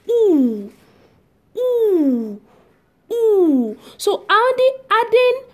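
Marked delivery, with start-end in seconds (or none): 0.1-3.8 "oo" as one long, falling vowel; French OU sound. Shown as a demonstration. Teacher demonstrating O U for learners, long oo sound. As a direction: hold each "oo" separately, drawn out. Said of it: "which is pronounced".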